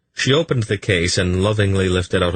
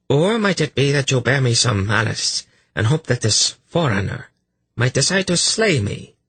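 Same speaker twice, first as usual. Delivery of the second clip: higher-pitched, accusing questioning; cruel priestess; 7 semitones higher than usual. A narrator's reading. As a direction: press the frightened priest harder. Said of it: "face grew even more cruel".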